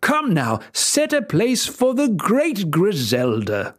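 king hurries to seat the angry fairy; fussing king. Acted. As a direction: order the servants with false cheer. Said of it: "said the king".

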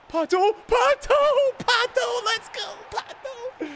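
high, stretched-out cheer; excited cheering. Real, not acted. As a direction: chant his name like a fan. Cheering his striker.

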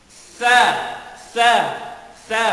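Sa said with the tongue between the teeth; Arabic tha sound, tongue tip between the teeth; Arabic letter sa. Shown as a demonstration. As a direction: lisp the s through the teeth for Sa. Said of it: "Ang iyong dila ay nasa pagitan".

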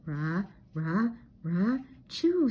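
a huge, rough cartoon sneeze, voiced like a dog; big dog sneeze. A narrator's reading. Scooby sneezes hard in the cornfield, a big dog sneeze. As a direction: sneeze so hard you fall over. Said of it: "Scooby sneezed so hard".

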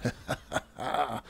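short run of light laughter; quiet, pleased chuckle. Real, not acted. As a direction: laugh it off, softly. Laughs on finding what they sought.

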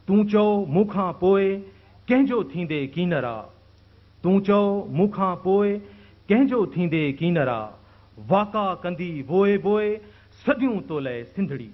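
poetry recitation. Acted. Host introducing Shaikh Ayaz with his verse, declaimed.

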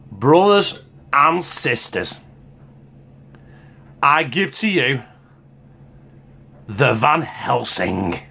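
slow mock-grand reveal; grand announcement. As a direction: present the burger like a showman. Unveiling his burger to viewers.

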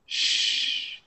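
long, soft voiceless "Shh"; isolated sh in "Shh", held for the learner; sh sound alone. Shown as a demonstration. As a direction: sustain "Shh" as a quiet hiss. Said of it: "es más S H".

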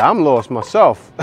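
slow loud exclaim; loud disbelief. Real, not acted. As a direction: belt it out, baffled. Commenter laughing in disbelief.

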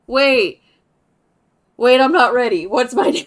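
loud, startled, flustered; real flustered excitement. Real, not acted. Streamer caught off guard by the naming screen, flustered loud excitement. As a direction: caught off guard, not ready at all.

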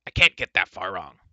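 loud, grumpy dwarf voice; irritated self-defence, loud; Dolly, exasperated. A narrator's reading. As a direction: protest crossly and loudly.

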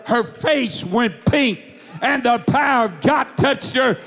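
high, building preaching voice; rising preaching climax. Acted. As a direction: swell it into the climax. Climax of the healing story.

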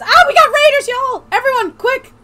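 high, loud, thrilled call; rally everyone at the top of your voice; excited rallying shout. Real, not acted. Streamer hurries friends to do raider dance, excited shout.

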